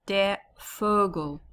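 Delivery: "Vogel" with an F sound, "Fogel"; "Der Vogel", V like F. Shown as a demonstration. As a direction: start "Vogel" on an F, not English V. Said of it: "it's more the F sound in German".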